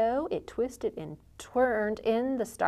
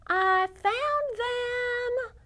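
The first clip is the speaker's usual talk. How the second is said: high, slow delighted call; child's delighted high call; excited find; much slower than usual. Acted. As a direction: call out the discovery proudly. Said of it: "Cowboy Baby shouted to Sheriff Pa".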